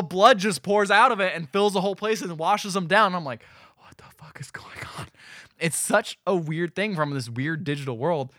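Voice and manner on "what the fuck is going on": breathless whisper of disbelief; whispered shock. Retold or imitated. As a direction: whisper it like you can't believe your eyes. His shocked reaction watching.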